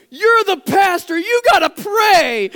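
high, loud, slow frantic demand; frantic friend. Retold or imitated. Friend begs the pastor to pray, high loud shout. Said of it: "she's like".

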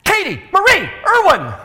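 father scolding his daughter; scolding full name. Retold or imitated. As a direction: call her full name, she's in trouble. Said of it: "I used all three names for her".

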